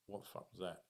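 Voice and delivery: quiet, rattled question to himself in the bathroom; shaken hush. Retold or imitated. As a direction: ask it quietly, still shaken. Shaken after fleeing to bathroom.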